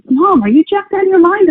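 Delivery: a hint of a teenage boy asking his mom; mock teen son.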